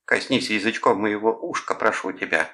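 put-on lovey-dovey begging; playing along as lover. A narrator's reading. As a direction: play along as the swooning girlfriend. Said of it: "подыгрывал Клод".

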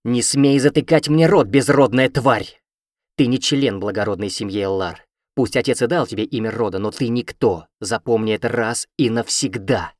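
loud, furious hissing tirade; angry hiss. Acted. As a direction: spit the insults out loud and vicious. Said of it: "Зло зашипела она".

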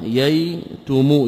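ييتم stretched very slowly, yaa then taa clearly voweled; slow spelled-out verb. Shown as a demonstration. Teacher demonstrating the present-tense form, slowly drawn out. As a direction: drag out ييتم to show its vowel pattern. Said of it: "ويقال يتم".

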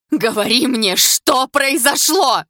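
high, loud, drawn-out demand; full-lunged scream. A narrator's reading. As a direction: scream the question with all your might. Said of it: "закричала во всю мощь лёгких".

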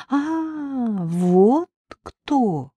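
высокое удивлённое «А», иным голосом; вдруг догадаться; удивлённое понимание. Acted. Надежда понимает, о ком речь. (Надежда внезапно понимает, кто это)

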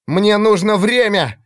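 high, slow shout. Acted. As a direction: cry it out in battle. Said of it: "выкрикнул Иллиан".

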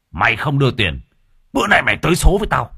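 fast furious shout; explosive rage. Acted. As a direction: spit the death threat. Thug smashing the table.

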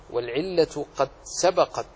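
normal talking voice

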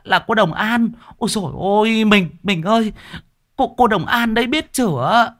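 high, flustered falsetto; dismayed woman. A narrator's reading. Wife realizing they beat a famous medium, flustered dismay. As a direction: stammer deferentially in a high female voice.

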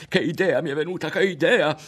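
put-on high, loud boyish cry; excited shout. A narrator's reading. Berto shouts to Ario as an idea strikes him. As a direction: burst out with a sudden idea.

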